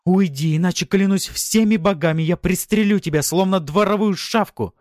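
high, loud, desperate woman; furious threat. A narrator's reading. Wife threatening to shoot husband, furious. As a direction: swear it at full pitch.

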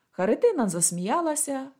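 plain reading voice

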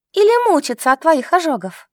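high, loud accusing question. A narrator's reading. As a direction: throw it back as an accusation. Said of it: "смутилась от таких обвинений". (обвиняюще, высоко и громко)

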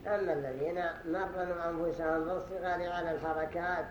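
ordinary talk

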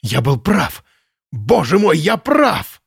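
high exultant exclamation; manic joy. A narrator's reading. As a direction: cry out in crazed triumph. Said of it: "Радостно воскликнул он". (радостное восклицание)

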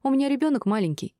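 high, reproachful explanation; annoyed young neighbour; displeased. A narrator's reading. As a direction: complain in a high voice. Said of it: "стояла недовольная девушка".

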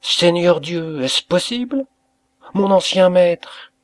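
high-pitched, astonished outcry; surprised exclamation. A narrator's reading. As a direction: exclaim in amazement, voice lifted well up. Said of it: "une exclamation de surprise".

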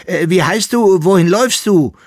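high, loud shout after someone leaving; calling after. Acted. Emperor's son calls after the boy running out, loud. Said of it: "rief ihm der Sohn des Kaisers nach".

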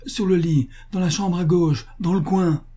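high-pitched, eager pointing out; urgent directions. A narrator's reading. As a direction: direct the rescuer anxiously. Butcher urgently directs the rescuer.

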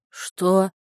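mumbled through a mouthful; mouth full. A narrator's reading. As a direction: say it while chewing. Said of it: "Пробормотал он с набитым ртом".

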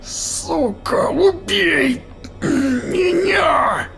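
high, slow anguished yell; desperate shout. A narrator's reading. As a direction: scream the plea. Giant begs to die.

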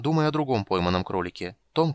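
usual speaking voice

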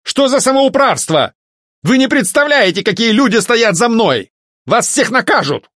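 high-pitched outraged yelling. A narrator's reading. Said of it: "это орал Альфред".

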